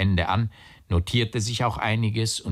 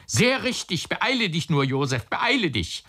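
high-pitched, urgent hurrying; excited cry; 10 semitones higher than usual. A narrator's reading. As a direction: cry out high, urging him along. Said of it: "schrie der Onkel". (aufgeregt und drängend ausgerufen)

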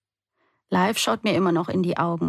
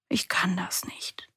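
slow, soft whisper into his chest; a slow hushed whisper, close and small; 6 dB quieter, slower than usual. A narrator's reading. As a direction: breathe the words, barely voiced. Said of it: "flüstere ich in seine Brust".

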